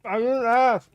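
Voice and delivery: high whining complaint; whiny. Real, not acted. Complaining about chilies.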